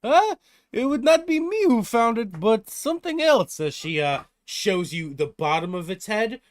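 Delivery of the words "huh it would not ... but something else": put-on high, loud Russian-accented giantess; surprised Russian-accented denial; thick Russian accent. Blacksmith denies finding the head, in a Russian accent. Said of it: "with a thick accent".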